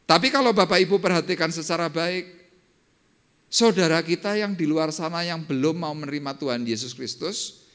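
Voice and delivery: fiery sermon climax, stretched words; preaching at full force. Acted. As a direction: drive the point home to the congregation.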